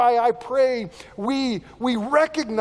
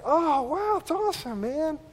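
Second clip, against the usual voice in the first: impressed congratulation; 8 semitones higher than usual. Retold or imitated. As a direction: marvel at a friend's good news, pitched well up. Retold reaction to friend's baby news.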